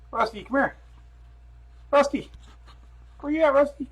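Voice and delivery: high, loud pet call; calling the dog. Real, not acted. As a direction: call out for a dog that won't come.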